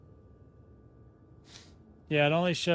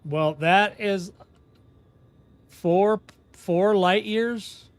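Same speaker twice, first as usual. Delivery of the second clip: slowed-down, rising, louder disbelief; 4 semitones higher, 5 dB louder, much slower than usual. Real, not acted. Streamer reading an absurd distance, slow stunned disbelief. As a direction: drag out the words as if it can't be true.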